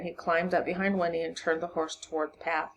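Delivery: plain reading voice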